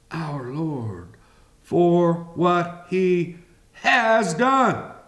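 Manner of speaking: usual speaking voice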